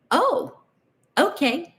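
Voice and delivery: a high, startled little "Oh, okay"; surprised oh okay. Retold or imitated. Replaying her stunned answer to the phone offer, surprised. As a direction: caught off guard, hiding the shock.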